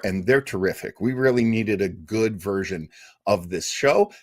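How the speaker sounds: normal talking voice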